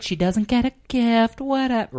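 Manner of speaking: hammed-up martyr mom, stretching the vowels; wounded mother. Mom who got no present, theatrically wounded. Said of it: "she's like".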